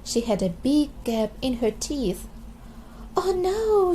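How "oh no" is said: high, loud little wail of dismay; dismayed cry. A narrator's reading. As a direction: gasp it out in childish alarm. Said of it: "she cried".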